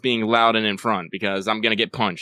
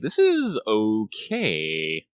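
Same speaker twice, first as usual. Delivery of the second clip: drawn-out, very slow approval; dragged-out lukewarm verdict; slow grudging verdict; 3 dB quieter, much slower than usual. Retold or imitated. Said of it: "I'm all like".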